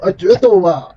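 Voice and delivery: high, loud mock duel call; playful challenge. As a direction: challenge them to a duel in fun.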